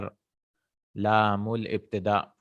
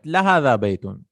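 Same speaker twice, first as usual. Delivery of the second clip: لا هذا pushed out loud and quick; emphatic; 3 semitones higher, 5 dB louder, much faster than usual. Shown as a demonstration. Teacher showing emphasis to a doubter, stressing لا هذا. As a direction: lean hard on لا هذا, then drop on بيت. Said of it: "It is emphasis".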